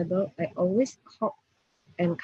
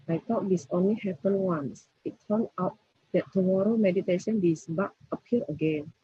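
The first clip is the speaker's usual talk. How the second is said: ordinary talk, nothing marked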